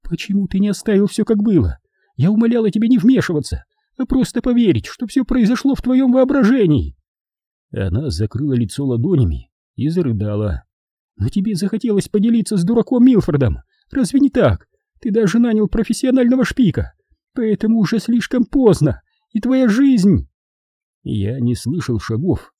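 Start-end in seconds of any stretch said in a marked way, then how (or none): 0.0-6.9 high falsetto reproach; high-pitched, urgent pleading; desperate, pleading woman. Acted. Voices Edvina pleading with Bobby. 11.2-20.3 high falsetto tirade; high-pitched bitter accusation; desperate, bitter woman. Acted. Voices Edvina reproaching Bobby.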